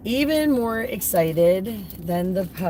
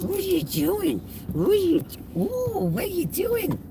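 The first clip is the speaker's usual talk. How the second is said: much higher, cooing at the dog; high puppy talk. Real, not acted. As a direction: coo a question to the puppy. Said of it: "repeated higher".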